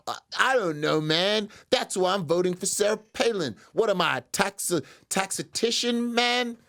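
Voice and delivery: high-pitched defensive dimwit; mock clueless voter. Voter stumped on tax rates, defensive mock voice.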